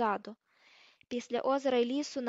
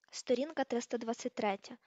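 ordinary delivery, nothing marked

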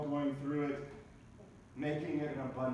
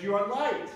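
louder, higher on light; proclaiming; 5 semitones higher, 7 dB louder than usual. Acted. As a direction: ring out the word light.

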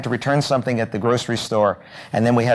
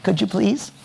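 a higher, sweetened voice asking nicely; sugary polite request; 8 semitones higher, 3 dB quieter than usual. Retold or imitated. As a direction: coax the cashier with honey in the voice. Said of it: "you catch more flies with honey".

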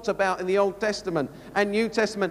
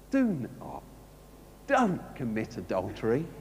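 gentle plea; 12 semitones lower, 6 dB quieter than usual. Shown as a demonstration. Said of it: "It says".

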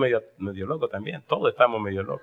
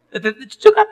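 worried mother; 13 semitones higher, 8 dB louder than usual. Retold or imitated.

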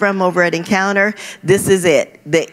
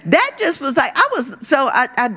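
ordinary talk

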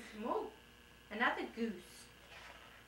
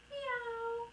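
high mewing kitten; kitten meow; 12 semitones higher than usual. Pretend. Mom mewing high like a kitten for her child. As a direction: mew like a tiny kitten, high. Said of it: "And they say".

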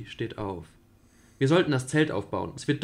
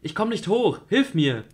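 loud and much higher, hanging on; desperate cry for help; 8 semitones higher, 7 dB louder than usual. Acted. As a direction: call out from the cliff edge. Julie dangling over abyss. (verzweifelter Hilferuf)

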